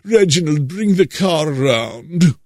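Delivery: a hint of a posh English butler-master voice; mock posh English accent. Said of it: "with the wonderful accent".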